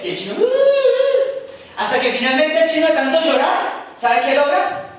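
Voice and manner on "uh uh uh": high, slow hammed-up child sobs; mock child sobbing. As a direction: fake the wailing of a crying kid. Child cries in story.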